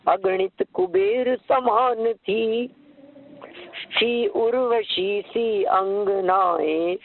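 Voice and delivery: slow, drawn-out devotional chant; slow reverent poem recital; verse recitation. Acted. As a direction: intone each phrase slowly, like a hymn.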